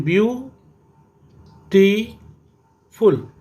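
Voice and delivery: Beautiful very slowly as view, टी, फुल; beautiful split into three separate beats; syllable by syllable. Shown as a demonstration. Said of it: "मैंने रोका और चलाया".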